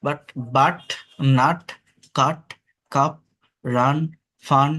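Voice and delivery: বাট, নাট, কাপ each short with a clear আ; teacher word drill. Shown as a demonstration. Teacher drilling the u sound for students. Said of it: "ইউ এর উচ্চারণ আ হয়".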